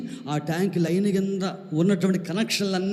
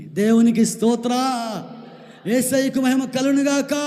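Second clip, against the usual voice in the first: high, loud hallelujah cry; ringing preacher's praise; praise shout; 6 semitones higher, 4 dB louder than usual. Acted. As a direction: proclaim glory to Jesus. Praise exclamation in sermon.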